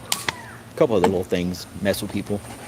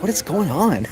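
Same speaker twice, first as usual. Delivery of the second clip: puzzled coworker; 9 semitones higher than usual. Retold or imitated. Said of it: "She's like".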